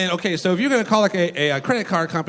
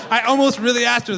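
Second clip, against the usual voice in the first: laughing shout; 3 semitones higher than usual. Real, not acted. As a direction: blurt it while cracking up. Laughing through the admission.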